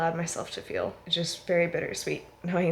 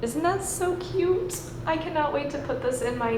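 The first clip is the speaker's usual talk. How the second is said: gushing excitement; 8 semitones higher than usual. Real, not acted. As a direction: squeal over how cute it is. Showing off a gift sign.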